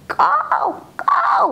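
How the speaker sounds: shrill, high crow cawing. Acted. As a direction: caw out like a thirsty crow. Crow crying out from thirst. (ఎత్తైన స్వరంలో కాకి అరుపు)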